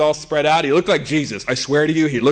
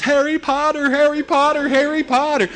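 high-pitched mock child's wail; wailing daughter; 11 semitones higher than usual. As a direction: imitate a distraught kid repeating a name. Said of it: "all my daughter can keep saying is".

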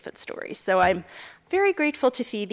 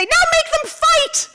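put-on high, slow gleeful kid voice; mother voicing her small daughter, high and gleeful; excited little girl; 3 dB louder, slower than usual. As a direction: demand the fight with glee.

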